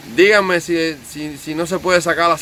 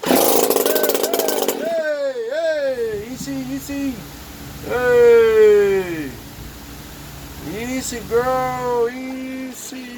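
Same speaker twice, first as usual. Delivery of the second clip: soothing a horse; 8 semitones higher, much slower than usual. Pretend. Coaxing the machine like a horse.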